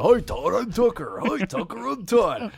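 high, bright mock introduction; cheery greeting. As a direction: chirp it like a cheerful stranger.

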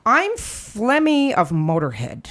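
ordinary talk, nothing marked

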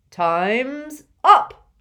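loud, cheerful call; genial call. A narrator's reading. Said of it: "called Slughorn genially".

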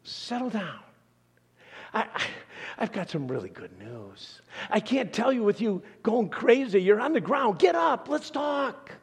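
an angel shouting to calm panicking shepherds; angel calming panicked men. Retold or imitated. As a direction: call the shepherds by name and settle them down. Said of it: "Did the angel say".

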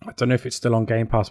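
ordinary talk, nothing marked

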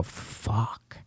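muttered under breath; whispered curse. Retold or imitated. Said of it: "And I go".

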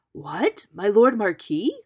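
loud, rising question from a king; storybook king's surprised outburst; astonished cry. A narrator's reading. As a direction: exclaim in delighted royal astonishment. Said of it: "cried the king".

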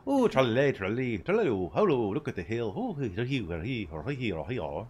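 sing-song fake Irish accent; hammed-up fake Irish lilt. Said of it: "sounds like fake Irish".

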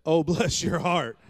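amused Southern put-down, drawn out with a laugh; laughing bless your heart. Retold or imitated. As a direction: laugh it out like a knowing congregation. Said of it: "And the church said".

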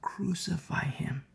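slow, near-whispered crowd cry; hushed mock outcry. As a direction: draw out the crowd's cry under your breath. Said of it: "they cry out in verse thirteen".

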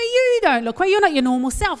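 high, loud imitation of a blunt British mate; cheeky British friend. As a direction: put on the friend's tactless, insistent verdict. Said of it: "He's British, very cheeky".

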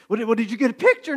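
fast, high imitated question; insistent questioning. Cindy still quizzing him, mock-insistent.

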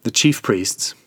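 plain reading voice

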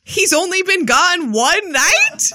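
drawn-out, loud disbelief; slow and high, can't believe it; incredulous. Acted. Disbelief after movie clip.

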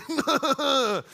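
put-on goofy teenage laugh; high schooler laugh. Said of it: "my high schooler voice".

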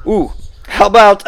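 ordinary delivery

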